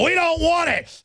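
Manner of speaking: high, hammed-up yell of refusal; mock zombie shout. Zombies refusing the cure, mock shout. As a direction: shout it like a snarling undead mob. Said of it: "And they go".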